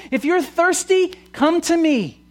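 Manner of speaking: high, loud proclamation as Jesus; calling out. Retold or imitated. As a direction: cry the invitation out to a crowd. Jesus calling out to festival crowd.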